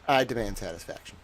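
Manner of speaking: quiet fast repeat. Pretend. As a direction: mutter it quickly after the big line. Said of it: "nineteen thirties news reporter towel".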